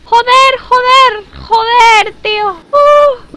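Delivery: high, loud panicked cursing; startled scream. Real, not acted. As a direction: shriek in sudden fright. Jump scare in horror game.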